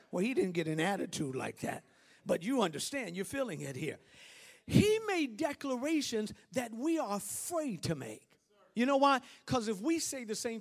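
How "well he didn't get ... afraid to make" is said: usual speaking voice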